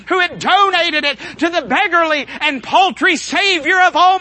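high, driving pulpit cadence; full-force preaching. Real, not acted. Preacher recalling Christ's own burial, full force. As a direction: preach it in one mounting, ringing run.

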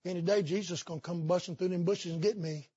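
lightly voiced old lady, soft and low; a hint of a quiet old woman's voice. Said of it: "She said".

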